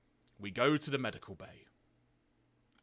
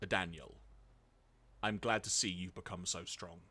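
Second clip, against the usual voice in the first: low, soft voice of a dying father; hammed-up deathbed father, pausing solemnly; Spike; 5 semitones lower, 5 dB quieter than usual. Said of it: "try and recreate the voice I did".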